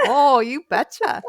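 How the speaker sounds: hammed-up Minnesota accent mimicking her sister, laughing. Host teasing her sister with a laughing Minnesota accent. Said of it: "my sister has the Minnesota O".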